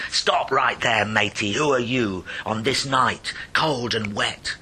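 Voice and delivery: loud, bullying shout at the smuggler; hollering excise man. Acted. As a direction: bellow it across the dark beach. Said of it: "the excise man he hollered".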